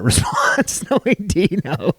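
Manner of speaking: real laughter breaking through the words; words stretched out by laughing. Real, not acted. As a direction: laugh your way through it, slow and high. Amused at imagining Dino's reaction.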